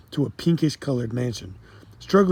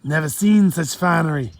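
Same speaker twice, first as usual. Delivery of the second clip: put-on Jamaican accent; 4 semitones higher, 5 dB louder than usual. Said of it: "heavy accent".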